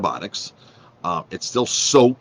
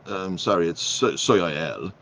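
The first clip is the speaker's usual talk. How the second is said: slow, halting mock French waiter; French accent; slower than usual. French waiter apologizes about the fryer, halting French accent. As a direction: stumble through it in a put-on French accent. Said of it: "So the waiter goes in this French accent".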